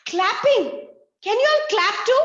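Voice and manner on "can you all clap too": excited call. Real, not acted. Teacher getting the class to clap along, excited. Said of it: "asks kids to clap".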